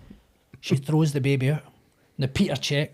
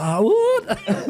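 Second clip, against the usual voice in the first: put-on stunned kid; 8 semitones higher, 4 dB louder than usual.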